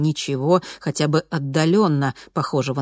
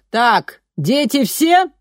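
high, loud teacher's call; rounding up the kids like a nursery teacher; kindergarten teacher voice; 9 semitones higher, 6 dB louder, much slower than usual. A narrator's reading. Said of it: "тоном воспитательницы закричала она".

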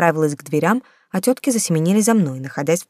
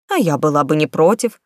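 ordinary delivery, nothing marked

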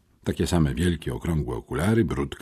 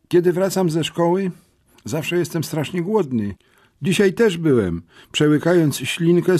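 put-on a slightly higher, eager boy telling his tale; young boy voice; 9 semitones higher than usual. A narrator's reading. Smerf tells the older boys his story, a little higher and eager. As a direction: tell it as the hungry, dreamy kid.

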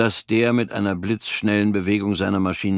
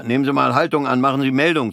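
befehlender Offizier; 6 semitones higher, 5 dB louder, faster than usual. Acted. Offizier befiehlt dem Tobenden.

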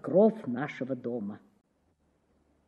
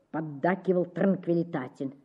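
usual speaking voice

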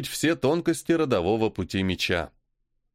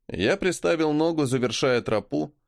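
usual speaking voice

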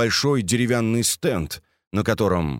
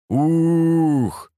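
long, higher booming hoot; hooted "ooh"; 7 semitones higher, 4 dB louder than usual. A narrator's reading. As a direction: hum out a drawn "ooh" on one held note. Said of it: "прогудел он". (протяжно прогудеть «ух»)

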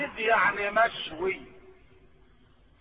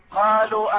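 usual speaking voice

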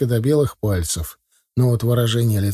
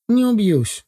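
put-on high, snuffly boy voice; nasal whine; 9 semitones higher, slower than usual. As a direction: say it through a stuffed nose, high. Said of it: "гундоса сказал парень". (гундосо, по-мальчишески высоко)

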